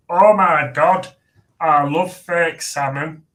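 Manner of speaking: slow, loud, high gushing voice; drawn-out gushing impression of Alex; imitating Alex. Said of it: "'cause that's how she talks".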